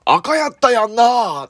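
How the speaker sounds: high, loud, drawn-out cry checking the light; loud call. Retold or imitated. Brother just knocked off his bike, yelling slowly and loudly. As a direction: shout it slowly to prove you're innocent. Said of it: "大きい声で".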